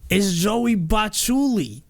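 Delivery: New York Joey accent. Shown as a demonstration. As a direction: stretch out the name like a New York tough guy. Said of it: "it's like".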